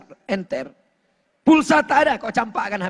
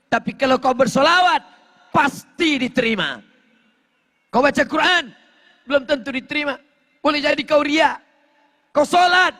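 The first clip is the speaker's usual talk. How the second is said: raised-pitch, punchy contrasts; full-force preaching; 7 semitones higher, 3 dB louder, faster than usual. Real, not acted. Preacher urging congregation to recite sholawat, full-force preaching. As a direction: drive each short phrase home, then pause.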